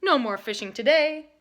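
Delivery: loud stern order; Gramps yelling. A narrator's reading. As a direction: call the trip off. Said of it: "Gramps yelled".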